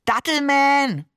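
high, drawn-out excited call; child's gleeful, stretched-out cry. A narrator's reading. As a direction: call it out long and proudly. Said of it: "rief der Seestern".

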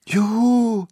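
high, loud whoop of delight; child's joyful call. A narrator's reading. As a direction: cheer out high like a happy child. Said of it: "rief ich".

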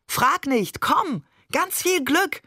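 high, drawn-out, swooping cajoling call; eager calling out. A narrator's reading. Father urging his family round the shrine, high eager call. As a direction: urge them along with exaggerated cheer. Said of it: "rief Barbe".

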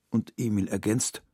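plain reading voice

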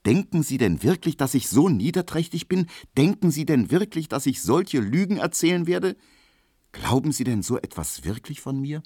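high, pleading questions; earnest protest. A narrator's reading. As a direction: appeal urgently, easing off at the end. Emil earnestly protests his innocence.

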